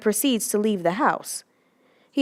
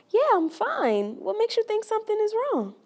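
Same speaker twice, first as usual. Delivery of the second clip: fake cheerful; 11 semitones higher than usual. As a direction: cover the hurt with a bright smile. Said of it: "through a fake smile reply".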